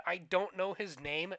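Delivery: ordinary delivery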